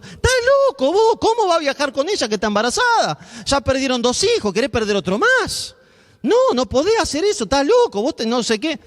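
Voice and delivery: high, scolding parent telling off his son; a parent's high-pitched reproach, a mock rant. Parent scolds son about the pregnant wife, high and scolding.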